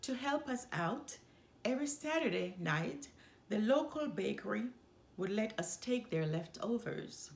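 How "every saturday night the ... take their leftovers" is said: ordinary delivery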